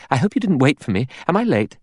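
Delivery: high, hurried woman's voice; said quickly, breathless. A narrator's reading. Latecomer hurries in to lunch, speaking quickly. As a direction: rush in apologetically, pitched well up. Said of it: "She said quickly".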